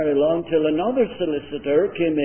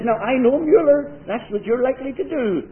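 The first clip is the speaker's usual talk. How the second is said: high, louder insistence; insistent; 10 semitones higher, 4 dB louder than usual. Retold or imitated. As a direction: press the point hard, pitched up. Lawyer insisting to colleague.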